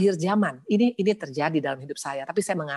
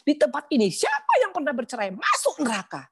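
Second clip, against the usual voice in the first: higher, louder accusing question; sister's harsh question; 10 semitones higher, 4 dB louder than usual. Retold or imitated. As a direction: mimic the preacher sister's condemning line. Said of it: "Saya punya sister mengatakan begini".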